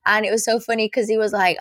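ordinary talk, nothing marked

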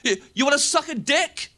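imitating a rowdy teenage lad; mock lad shout. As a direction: taunt a mate across the bus. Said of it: "Shouting that really loud".

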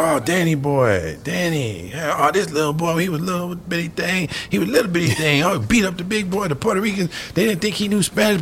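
put-on high, thin old man's voice recalling a boy; old man on the stoop. Telling the visitors about little Danny in a high old man's voice.

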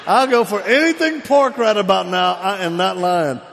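loud, high exasperated outburst; comic desperation. Retold or imitated. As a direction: belt it out as if at wits' end. Comic desperation for any pork.

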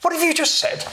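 a teacher pouncing on a remark; a supervisor catching her out; inquiring supervisor. Retold or imitated. Supervisor questioning daughter.

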